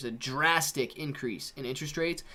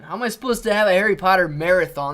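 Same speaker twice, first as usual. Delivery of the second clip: ordinary talk, nothing marked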